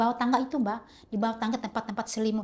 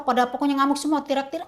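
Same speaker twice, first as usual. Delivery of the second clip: normal talking voice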